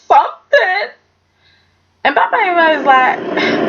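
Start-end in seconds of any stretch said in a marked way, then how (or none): 0.1-0.9 high-pitched wheedling daughter; hammed-up whiny appeal; mock pleading. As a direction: plead with a father, rising to a wail. Acts daughter pleading with father.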